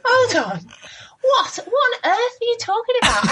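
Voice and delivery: high, laughing Cockney disbelief; a touch of a London Cockney sister, amazed. Retold or imitated. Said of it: "who's a Cockney".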